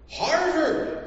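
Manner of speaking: high, loud astonished cry; amazed exclamation. Acted. Census taker hearing the brother is at Harvard, astonished cry. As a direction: burst out in disbelief. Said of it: "exclaimed the census taker".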